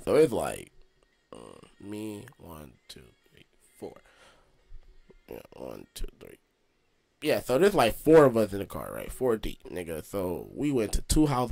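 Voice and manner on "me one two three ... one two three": very quiet, barely voiced count; counting to himself. Real, not acted. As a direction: mutter the numbers under your breath.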